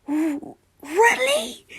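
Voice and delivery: high, loud disbelieving question; stunned, can't believe it; shocked. Acted. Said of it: "asked in shock".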